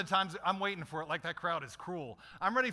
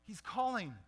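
eager crowd; 5 semitones higher than usual. Acted. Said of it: "they changed their tune".

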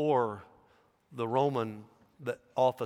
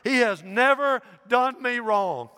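proud reply; 11 semitones higher, 8 dB louder than usual. A narrator's reading. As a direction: proclaim his loyalty high and loud. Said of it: "replied Polycarp".

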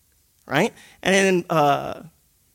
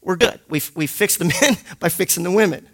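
usual speaking voice

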